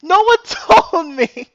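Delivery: high, slow, loud wail through laughter; laughing disbelief. Real, not acted. Streamer nobody warned, wailing through laughter.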